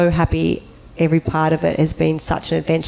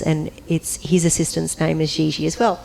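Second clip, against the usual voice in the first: ordinary talk, nothing marked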